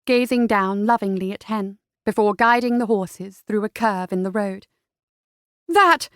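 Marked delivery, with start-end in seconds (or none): 5.7-6.1 high alarmed shriek; a startled, high, loud yelp of alarm. A narrator's reading. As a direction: cry out in sudden fright at the danger ahead. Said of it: "Henrietta shrieked".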